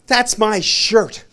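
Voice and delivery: slow, drawn-out "that's my shirt"; mock indignant. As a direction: claim it slowly and pointedly. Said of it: "Marty saw it and he went".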